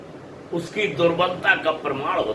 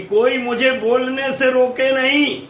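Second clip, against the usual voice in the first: high-pitched public appeal; addressing everyone; 5 semitones higher than usual. Acted. Kaikeyi asks all to let her speak, high declamatory address. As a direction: lift the voice to the assembly. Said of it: "सभी लोगों को संबोधित करती हुई".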